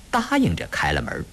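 ordinary delivery, nothing marked

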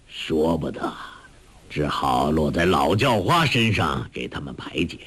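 low, easygoing elder; old beggar master. Acted. Old master consoles the weeping disciple, low elder's voice.

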